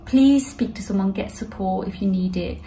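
ordinary talk

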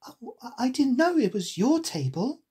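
lightly flustered heroine; Alice. A narrator's reading. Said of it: "said Alice".

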